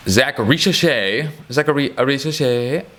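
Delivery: slow, drawn-out mock French accent on the name; a jokey, exaggerated French accent. Savouring a prospect's name in a playful French accent. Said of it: "That's my French accent".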